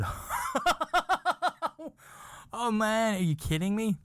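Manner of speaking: high-pitched, laughing disbelief. Real, not acted. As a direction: break into a high laugh mid-sentence. Cracking up mid-story in disbelief.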